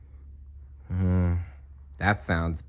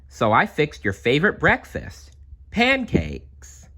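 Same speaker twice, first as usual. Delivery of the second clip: a lightly voiced cheerful mom, pitched up; mother voice; 8 semitones higher than usual. Mother offers her daughter pancakes, pancakes lilting high. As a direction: sing out pancakes like a happy mom.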